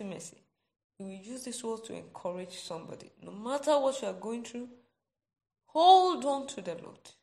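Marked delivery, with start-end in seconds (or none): 3.2-7.1 loud, rising appeal; preacher's loud, urgent plea to hold on; urgent exhortation. Real, not acted. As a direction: cry out to the congregation. Preacher urging the congregation.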